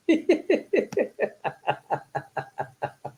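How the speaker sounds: long high giggle, fading down; high laugh. Real, not acted. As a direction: burst into a high, helpless giggle. Laughing at pajama suggestion.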